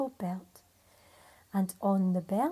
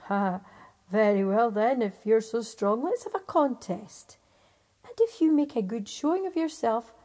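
louder, lightly voiced giant; challenging giant; 8 dB louder than usual. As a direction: set the challenge with a scoff.

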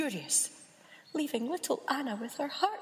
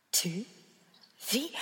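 usual speaking voice

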